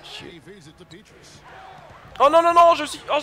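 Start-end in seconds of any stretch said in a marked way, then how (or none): 2.2-3.2 high, loud cry of dismay. Real, not acted. Gamer crying out at a blunder, loud. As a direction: yell no at the blunder. Losing the ball.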